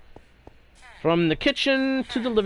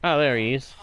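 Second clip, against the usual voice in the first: ordinary talk, nothing marked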